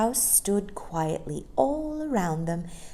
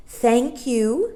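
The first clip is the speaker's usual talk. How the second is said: pointed, higher Thank you; meaningly; 8 semitones higher, 5 dB louder than usual. Acted. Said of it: "said Mary Poppins meaningly".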